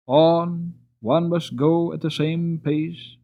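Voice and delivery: plain reading voice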